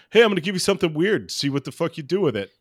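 fast, high teasing challenge; taunting writer. Retold or imitated. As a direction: toss it over like a dare. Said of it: "sort of a like".

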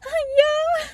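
very high, squeaked mock answer; awkward admission. Admitting to a stranger they dated, awkwardly squeaky. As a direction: squeak out a hammed-up yes.

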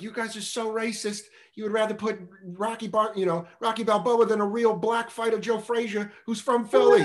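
high, hammed-up scolding of the crowd; mock outrage. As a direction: accuse them with mock indignation. Burr taunting Philly crowd.

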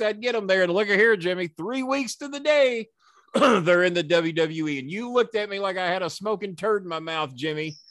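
high, emphatic wrestling call-out; gloating promo. Retold or imitated. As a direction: rub it in before the crowd, building then easing. Retold gloating promo against Jimmy.

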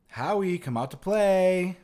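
high, loud sing-song call, drawn out; playful taunt. Retold or imitated. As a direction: call out teasingly to a child ghost. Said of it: "playful, like".

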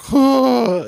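high, loud startled whoa; a high whoa on waking. Retold or imitated. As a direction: jolt awake with a whoa. Waking up from the dream.

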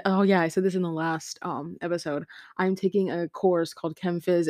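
normal talking voice

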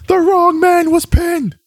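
high, slow mock shout; Samoa Joe yell. Undisputed Era yells at William Regal, mock shout. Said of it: "their best Samoa Joe impression, yelling".

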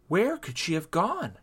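put-on puzzled mermaid girl; puzzled questioning. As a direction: ask it high, at a loss. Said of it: "Rita questioned".

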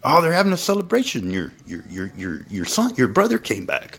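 chatty servant. Retold or imitated. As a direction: servant telling the older son the news, high and quick. Servant explaining celebration.

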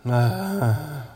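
loud sigh falling away; recovering sigh. Real, not acted. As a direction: sigh to compose yourself. Recovering before rereading the quote.

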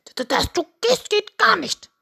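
very high, loud stammer; fast, flustered cartoon rooster; startled rooster. A narrator's reading. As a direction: stutter out a panicked protest. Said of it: "Henry bekam einen Schreck".

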